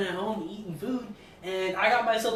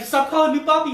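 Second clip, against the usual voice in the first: louder, higher stern cop; mock cop order; 6 semitones higher, 8 dB louder than usual. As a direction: bark it like an annoyed officer. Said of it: "And he's like".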